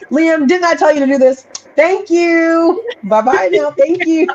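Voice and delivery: high, sugary customer service sweetness. Retold or imitated. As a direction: sweet-talk your son like a shop clerk. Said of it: "The customer service voice".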